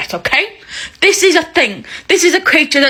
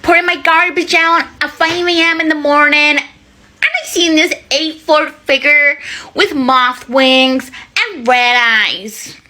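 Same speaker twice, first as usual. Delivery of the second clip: lightly voiced witness, awed and a touch slow; a hint of a spooked local telling her sighting; eyewitness woman; 4 semitones higher than usual. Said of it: "this woman, right?".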